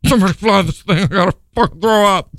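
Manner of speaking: playing a sickened pilot, mock disgust. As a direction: groan at the stink, half-retching.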